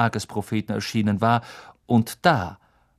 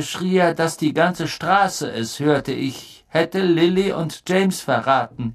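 raised, emphatic, slowed storytelling; intense, higher-pitched accusation; 7 semitones higher than usual. A narrator's reading. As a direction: recount the betrayal with growing force.

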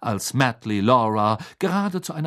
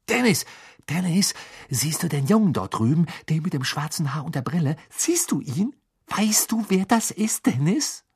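high, breathless, urgent, part whispered; eager excited brother; 7 semitones higher than usual. A narrator's reading. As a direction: nudge your brother, bursting to tell him. Brother points out Harry eagerly.

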